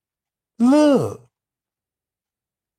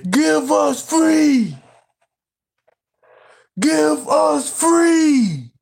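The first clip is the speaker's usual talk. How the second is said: slow, pleading cry of the Amistad hero; hammed-up tearful "give us free" shout; movie quote; 5 semitones higher, much slower than usual. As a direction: draw out the plea for freedom, half shouting.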